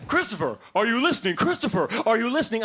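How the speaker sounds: repeated stern classroom callout; teacher calling out. Acted. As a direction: call the daydreaming kid back. Teacher calls distracted pupil.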